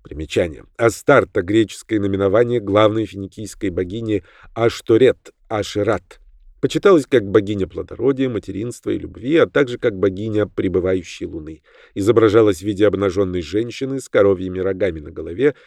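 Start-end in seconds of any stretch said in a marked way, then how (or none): none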